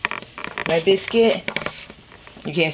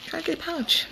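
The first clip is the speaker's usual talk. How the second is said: high, soft pet talk; 11 semitones higher, 9 dB quieter than usual. Real, not acted. Narrating dog's antics.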